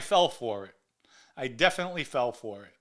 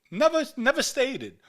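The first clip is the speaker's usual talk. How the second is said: sharp, high, loud denial; indignant outburst; 9 semitones higher, 4 dB louder than usual. Real, not acted. Host denying Sammy's story on air, loud and indignant. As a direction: fire it back at him, outraged.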